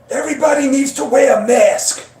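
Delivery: put-on high, loud raspy Brooklyn accent; hammed-up raspy Brooklyn doctor; Fauci Brooklyn accent. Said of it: "You need the raspy Brooklyn accent".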